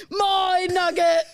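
high, loud and slow barked claims; hammed-up drill sergeant yelling over chips; greedy sergeant. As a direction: bark it like a sergeant grabbing food. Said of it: "military sergeant".

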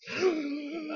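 high disgusted squeal of Karens. Real, not acted. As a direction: spit the name out in disgust. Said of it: "It tastes bad coming out of my mouth".

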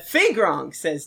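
ordinary delivery

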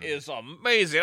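usual speaking voice

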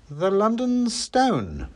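high, slow, drawn-out answer; suppressed triumph. A narrator's reading. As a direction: savour it while trying not to gloat. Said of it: "trying hard not to sound triumphant".

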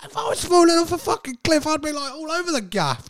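high, fast, flailing sing-song; mock panic. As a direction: act out thrashing wildly in a fall. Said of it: "It's like".

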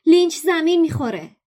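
high, loud shout. Acted. Harry shouting to his friends that Lynch will crash, high loud shout. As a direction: call out the crash before it happens. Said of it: "هری فریاد زد".

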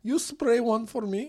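put-on high Ukrainian accent, asking; high-pitched comic Ukrainian-accented request; Natalie. Bride asking shop woman for a spray, Ukrainian accent. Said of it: "She talks like this".

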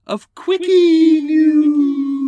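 high, drawn-out jingle shout. Acted. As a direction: belt the segment title like a jingle. Announcing the segment jingle.

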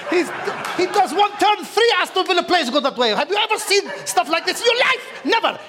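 put-on Arabic accent. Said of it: "keep talking in the Arabic accent".

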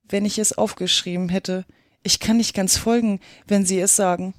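ordinary delivery

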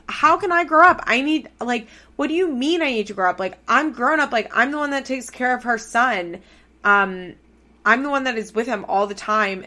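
high, loud, indignant rant as Kayla; imitating Kayla's fury. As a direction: fire back in outrage as the wronged mom. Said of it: "Kayla's like".

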